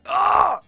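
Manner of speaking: high, loud mock shriek; a short hammed-up scream. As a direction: shriek in comic horror. Mock scream at Hojo line.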